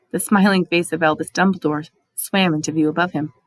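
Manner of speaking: plain reading voice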